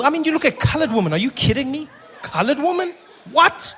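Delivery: high, fast disbelief; mock outrage, high and quick; incredulous. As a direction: act shocked at the question. Mock outrage at audience question.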